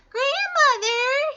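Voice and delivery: very high, slow falsetto call; fake little-girl voice. A narrator's reading. Wolf posing as Little Red at the door, fake girlish falsetto. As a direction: imitate a little girl, overdone and squeaky. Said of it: "in his best little girl voice".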